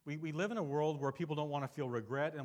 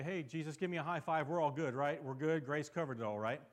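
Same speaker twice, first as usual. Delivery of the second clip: breezy, careless mock cheer, a bit louder; mock flippant; 4 semitones higher, 6 dB louder than usual. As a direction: play a believer brushing off sin with a high five. Mocking flippant believers.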